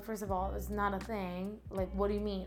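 ordinary delivery

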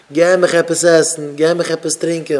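loud, insistent begging; pleading souls. Acted. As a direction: beg urgently for food and drink. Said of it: "sie weinen". (בעטנדיק און הויך)

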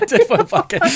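"Tinfoil bucket" said through a high laugh. Real, not acted.

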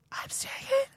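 squeaky lost voice. Retold or imitated. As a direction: imitate a failing high voice straining to protest. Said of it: "to, like, talk like that".

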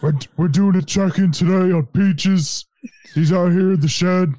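high, loud-ish comic check-in; imitated video guy talking about his cat; funny cat-owner voice. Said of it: "which in his voice is so funny".

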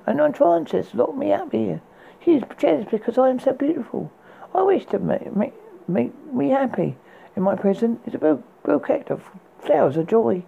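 put-on a saucy girl teasing from her tower; young maiden voice. Rapunzel teases the prince from the window, high girlish voice.

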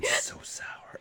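hushed complaint; whispering. Real, not acted. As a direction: whisper it, wincing. Whispered about drink.

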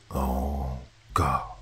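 low, soft despairing moan. A narrator's reading. Hero realizes he caused it, a low soft moan. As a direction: let it sink out of you in horror. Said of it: "I moaned".

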